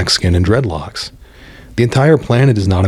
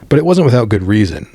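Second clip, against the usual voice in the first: usual speaking voice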